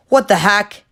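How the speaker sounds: loud angry shout. Acted. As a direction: bark at the kids, caught them. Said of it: "an angry voice cut the air".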